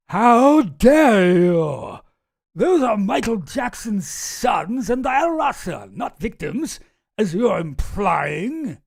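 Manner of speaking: drawn-out, hammed-up outrage; mock indignation. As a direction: imitate a scandalized reply, slow and loud. Acts out an outlet's indignant reply.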